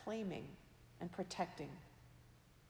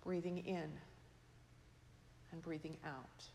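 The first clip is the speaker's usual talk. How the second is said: fading breath; 3 semitones lower, 9 dB quieter than usual. Shown as a demonstration. Preacher models slow breathing.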